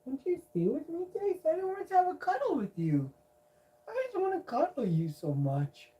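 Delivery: put-on high baby voice, pleading to the cat; baby talk. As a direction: coax the cat in a high sing-song.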